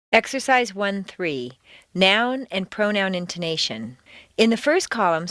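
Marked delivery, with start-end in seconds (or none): none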